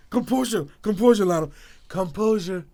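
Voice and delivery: struggling to keep composure; self-composure pep talk. Real, not acted. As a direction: talk yourself down from a giggle fit. Coaching himself out of laughing.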